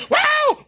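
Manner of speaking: high-pitched celebratory whoop; re-enacted jubilant "woo"; retold joyful whoop. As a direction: let out a new father's squeal of joy. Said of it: "I was shouting".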